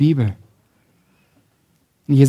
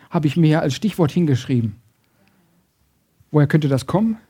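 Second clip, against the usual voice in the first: normal talking voice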